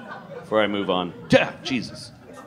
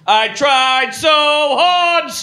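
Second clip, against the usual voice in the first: New Jersey belt; 8 semitones higher, slower than usual. Pretend. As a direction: belt it like a Jersey guy about his mom's recipe. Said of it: "a guy from New Jersey".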